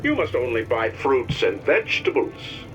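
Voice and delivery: rising outrage. Acted. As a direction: build into an angry rant. Propaganda cartoon voices build up.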